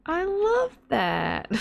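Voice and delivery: very high, drawn-out put-on voice; someone's impression of a streamer. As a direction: stretch it out high and slow. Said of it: "[name] does the impression so much now".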